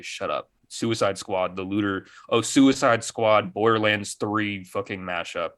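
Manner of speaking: ordinary delivery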